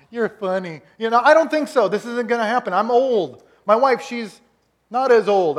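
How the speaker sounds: hammed-up old man scoffing; comic disbelief. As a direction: laugh it off in disbelief. Abraham laughing off God.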